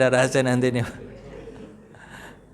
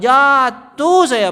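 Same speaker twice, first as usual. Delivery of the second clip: higher, louder, slow anguished cry; dramatic cry; 9 semitones higher, 8 dB louder, much slower than usual. Retold or imitated. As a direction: stretch it out like the fall still hurts. Retelling being pushed down. (teriakan pilu yang lambat dan keras)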